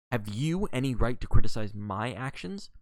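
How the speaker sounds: coolly challenging, with rising question; smooth, cool retort at full voice; cool and liquid. A narrator's reading. Mary answering her quarrelling husband, coolly challenging. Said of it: "Mary's voice came cool and liquid".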